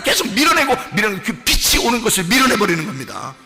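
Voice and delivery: high, insistent sermon delivery; impassioned preaching. Real, not acted. Preacher acting out God's word pushing against the old self, impassioned preaching. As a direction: press the struggle home urgently.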